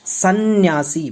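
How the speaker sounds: सन्यासी drawn out slowly, a doubled n heard; pron. Shown as a demonstration. Teacher sounds out सन्यासी for students, slowly. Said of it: "बोलने में दो न की आवाज़ आ रही है".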